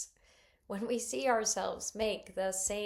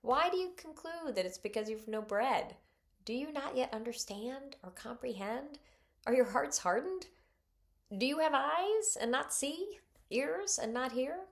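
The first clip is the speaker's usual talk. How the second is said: exasperated Jesus; 6 semitones higher, 4 dB louder than usual. Retold or imitated. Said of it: "his eyes are seriously rolling here".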